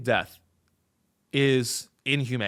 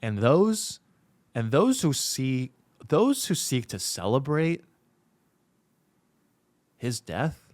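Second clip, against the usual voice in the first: mock solemn statement; 6 semitones lower, 5 dB quieter than usual. Commentator reading out a mock condolence statement, mock solemn. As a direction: deliver a hushed, faux-grave public condolence.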